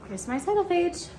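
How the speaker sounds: plain reading voice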